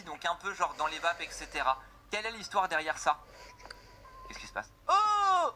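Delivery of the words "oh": high, drawn-out "oh" of shock; shocked cry. Real, not acted. Shocked by the character's new face. (cri de stupeur aigu)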